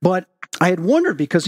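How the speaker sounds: usual speaking voice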